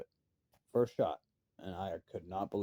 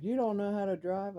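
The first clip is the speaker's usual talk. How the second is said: high retold yell of disbelief; half-acted holler, much higher than his own voice; 11 semitones higher than usual. Farmer hollering at the kid who started the truck, high retold yell. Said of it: "he come yelling at me".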